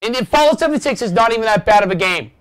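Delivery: high-pitched heated protest; yelling. Real, not acted. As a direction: argue it loudly and quickly. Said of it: "why I'm fucking yelling here".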